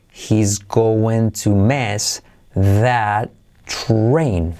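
very slow, word by word; slow, careful teaching delivery; slow model. Shown as a demonstration. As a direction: stretch each word of HE'S GOING TO MISS THAT TRAIN for learners.

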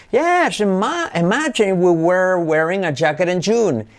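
high exclaimed "Yes", then lifted "imagine"; exaggerated English melody from a high "Yes"; intonation demo. Shown as a demonstration. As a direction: launch high on "Yes" and glide down through to "June". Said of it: "You got it, entonación".

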